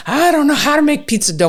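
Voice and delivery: high, fast panicked plea; mock panic. Acts out panicked plea to chef.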